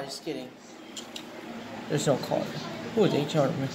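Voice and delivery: normal talking voice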